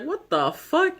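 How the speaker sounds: high-pitched mock disbelief; retold shocked reaction. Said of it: "And I was like".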